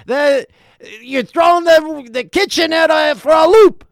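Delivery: high, loud shouted complaint; scolding waiter. Retold or imitated. Waiter scolding the table about the kitchen, loud high shout. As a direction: rant at the customers about the kitchen. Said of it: "He's like".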